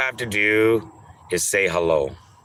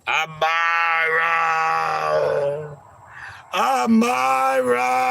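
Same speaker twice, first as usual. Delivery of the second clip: long, high wailing call of Amira; sing-shout her name into the distance; drawn-out crying call; 8 semitones higher, much slower than usual. Acted. Calling out for missing Amira.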